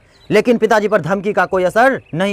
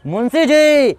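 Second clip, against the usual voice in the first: higher-pitched hailing call; 7 semitones higher, much slower than usual. A narrator's reading. Father calls to his son across the school ground, calling out. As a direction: call the name out from outside. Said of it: "बाहर से ही लेखक जी को आवाज़ देता है".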